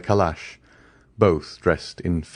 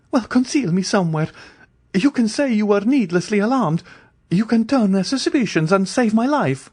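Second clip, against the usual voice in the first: high urgent pleading; 14 semitones higher than usual. Acted. Andrea urging the ladies to hide him, high and pleading. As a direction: coax them to hide you.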